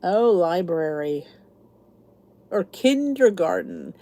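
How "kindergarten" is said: kindergarten slowly, with every syllable; standard pronunciation. Shown as a demonstration. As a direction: say kindergarten fully, the "der" sounded. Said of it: "Some people say kindergarten".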